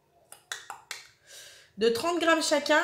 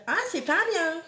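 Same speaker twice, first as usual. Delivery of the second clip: higher-pitched mimicked disapproval; mock scolding commenters; 6 semitones higher than usual. Mimicking viewers who will criticise the alcohol, mock scolding. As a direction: imitate tutting online commenters, pitched up. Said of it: "les commentaires qui vont me dire".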